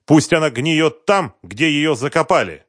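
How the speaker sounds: dismissive husband, voice pushed up and out; embittered husband. A narrator's reading. Husband refusing any search for his wife, bitter and loud.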